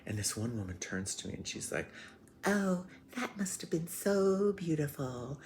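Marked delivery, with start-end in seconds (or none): 2.4-5.4 high, slow mock gushing; gushing listener. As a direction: imitate a sweetly enchanted tourist, pitch way up. Gushing tourist voiced in a story.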